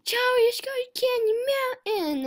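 very high, loud sing-song squeal; high-pitched nagging unicorn impression; pushy cartoon unicorn. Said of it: "It's like".

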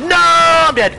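loud cry of defeat; man down shout. Real, not acted. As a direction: yell it as you die. Player killed in firefight.

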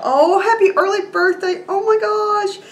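very high, touched squeal of delight; delighted surprise. Real, not acted. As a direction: let the voice soar with happy surprise. Reading a surprise gift note.

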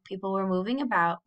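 ordinary delivery, nothing marked

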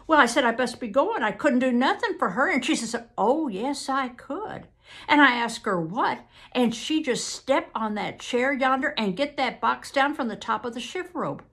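animated rural Southern testimony; earnest witness. Acted. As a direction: recount it eagerly in folksy Southern dialect. Witness recounts events.

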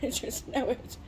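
breathy unvoiced whisper. Real, not acted. Mom vlogging, whispering a little confession. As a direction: whisper it like a secret. Whispered aside after laughing.

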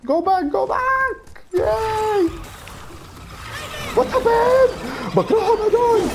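high, panicked game shouting; frantic yelling. Real, not acted. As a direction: yell it out in a panic. Frantic yelling during a fight.

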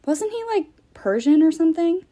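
incredulous question. A narrator's reading. Annabeth questions the ghosts.

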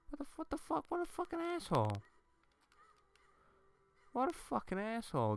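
squeaky, very high complaint; high-pitched indignation. Real, not acted. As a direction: whine high up at being attacked. Complaining after being attacked in game.

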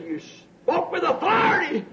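high ringing shout on spoke with authority; rising emphatic quote. Retold or imitated. Preacher quoting the dream to the congregation, shouted emphasis. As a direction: proclaim it with force.

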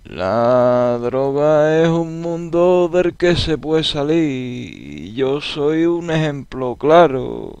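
slow, low, spaced-out stoned drawl; stoner voice. Shown as a demonstration. Vlogger mocking how a stoner talks, slow and low. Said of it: "Un fumado habla así".

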